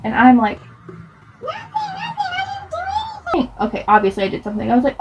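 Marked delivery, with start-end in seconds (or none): 1.4-3.5 tiny, very high innocent squeak; squeaky denial. Acted. Child telling mom nothing happened, squeaky denial. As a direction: plead innocence softly in falsetto.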